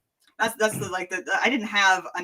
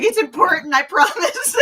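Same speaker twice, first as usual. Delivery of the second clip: laughing; 6 dB louder than usual. Retold or imitated. Pleading with mother, laughing.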